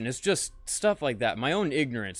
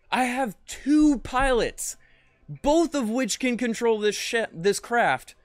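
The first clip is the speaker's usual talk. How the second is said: exasperated; 7 semitones higher, slower than usual. Real, not acted. Exasperated complaint to chat.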